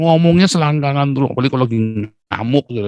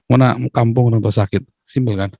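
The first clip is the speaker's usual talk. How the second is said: ordinary talk, nothing marked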